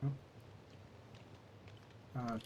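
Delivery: usual speaking voice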